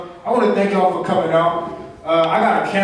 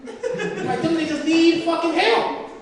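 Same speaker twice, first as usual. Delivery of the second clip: incredulous retelling; 10 semitones higher, slower than usual. Retold or imitated. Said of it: "I'm like".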